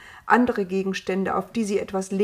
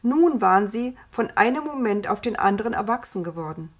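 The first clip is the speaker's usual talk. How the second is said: ordinary delivery, nothing marked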